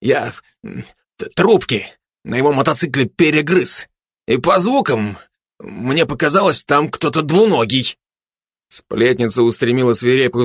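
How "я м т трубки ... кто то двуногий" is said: halting, broken-up explanation; hesitant stammering voice; slight stammer. Acted. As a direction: stumble over the first sounds, then explain haltingly. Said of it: "слегка заикаясь".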